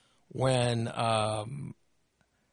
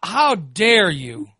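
mock outrage; 5 semitones higher, 4 dB louder, slower than usual. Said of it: "I'm like".

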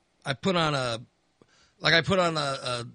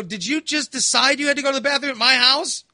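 high, loud indignant demand; indignant question; 8 semitones higher, 3 dB louder than usual. Retold or imitated. Son demanding why mother used his toilet, high indignant. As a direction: press her, scandalized.

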